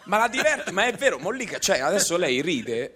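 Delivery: high, loud insisting; excited. Real, not acted. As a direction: push the point with a grin. Insisting she enjoys teleportation joke.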